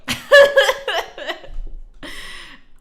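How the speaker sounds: big delighted laugh; loud laugh. Real, not acted. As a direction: burst out laughing. Laughing at chat.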